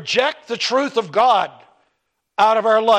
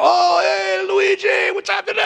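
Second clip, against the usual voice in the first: hearty greeting; 10 semitones higher than usual. Retold or imitated. The girlfriend's father hails him at the door, booming and jovial.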